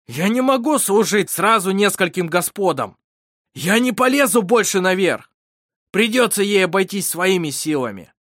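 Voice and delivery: loud, high cry full of bitterness; bitter shout. A narrator's reading. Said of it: "с горечью прокричал, шипя". (горький, громкий крик)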